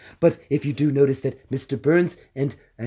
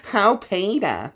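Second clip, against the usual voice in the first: slow, nasal, drawn-out call; nasal cartoon wife voice, higher; Lois; 7 semitones higher, much slower than usual. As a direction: stretch it out through the nose. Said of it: "Lois, I can do".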